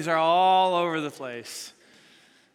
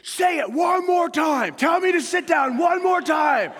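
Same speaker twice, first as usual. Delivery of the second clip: loud, slow, high challenging yell; bellowing a threat at a stranger in the stands; angry shout; 10 semitones higher, 6 dB louder than usual. Retold or imitated. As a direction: dare him to say it again, furious. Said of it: "this guy over here goes".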